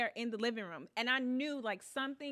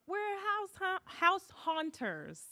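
high, drawn-out announcement; imitating pranksters; 9 semitones higher, much slower than usual. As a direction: announce it high and stretched, mimicking.

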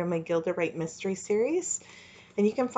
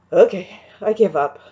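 louder exhausted groan; 8 dB louder than usual. A narrator's reading. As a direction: moan it out, spent from push-ups. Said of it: "groaned beside her".

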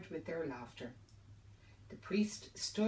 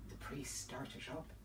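plain reading voice

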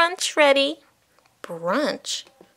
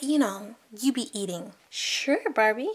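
ordinary talk

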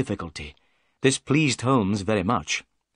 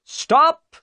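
high, loud police shout; 8 dB louder than usual. Acted. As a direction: yell across the water to halt them. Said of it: "he cried".

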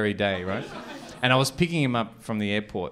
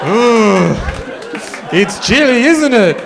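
much higher and louder, hammed-up shiver; mock shivering exclaim; 12 semitones higher, 11 dB louder, slower than usual. Comic man mocking his mate in the heat, hammed-up cold cry. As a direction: ham up the cold at him. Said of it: "I turn to him and go".

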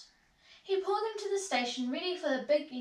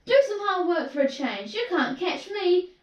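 higher, louder teasing; cheeky taunting engine; 5 semitones higher, 7 dB louder than usual. A narrator's reading. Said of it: "came up in a cheeky way".

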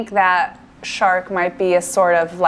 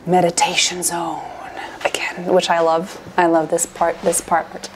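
whisper; 3 semitones lower than usual. Real, not acted. Gamer whispering to viewers about the meditation zone, unvoiced. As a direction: hush it like entering a calm place.

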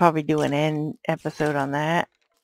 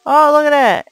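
higher, loud delighted exclamation; loud surprise; 8 semitones higher, 14 dB louder than usual. Real, not acted. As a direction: react to a lucky beheading. Surprised by a kill.

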